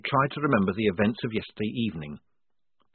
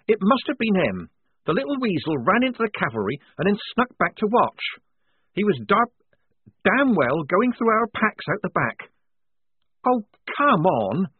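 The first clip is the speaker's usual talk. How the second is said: put-on higher, louder, indignant young woman; Polly's voice; 11 semitones higher, 5 dB louder than usual. A narrator's reading. Polly accusing Strappy in front of the squad, indignant and higher. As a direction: press the accusation, a little heated.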